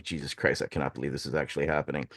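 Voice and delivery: low, quick, hushed aside; private aside. Retold or imitated. Sharing disbelief with mentor in line, low quiet aside. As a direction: say it softly under your breath in disbelief. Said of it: "like, giggling to ourselves".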